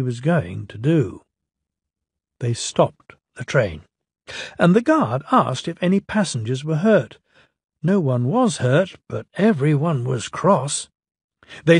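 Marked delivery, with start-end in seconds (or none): none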